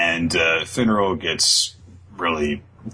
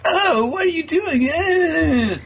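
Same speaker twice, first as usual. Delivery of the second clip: very high, loud squeal; jealous fairy; 3 dB louder, slower than usual. Retold or imitated. Podcaster voicing Sylph catching Charmy with Asta, high squeal. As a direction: squeal it out, offended. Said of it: "Sylph is like".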